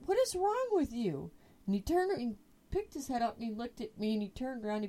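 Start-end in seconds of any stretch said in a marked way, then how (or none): 0.0-1.3 high, incredulous question to the dog; baffled. Retold or imitated. To her groaning dog, a high baffled question. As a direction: ask it in total disbelief.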